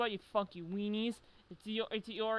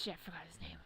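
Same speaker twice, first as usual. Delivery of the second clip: whispered aside; 2 semitones lower, 11 dB quieter, much faster than usual. Real, not acted. Muttered aside while stuck on a name.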